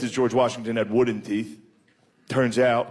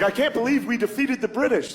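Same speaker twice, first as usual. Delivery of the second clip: mock George Washington; 10 semitones higher than usual. Washington voiced with lead teeth.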